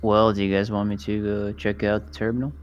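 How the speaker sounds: usual speaking voice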